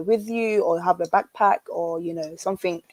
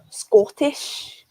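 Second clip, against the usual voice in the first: the word Scottish said in a light Scottish accent, pitched higher; quick Scottish accent demo on one word; 7 semitones higher than usual. Shown as a demonstration. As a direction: say Scottish the way a Scot would. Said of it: "this is the Scottish accent".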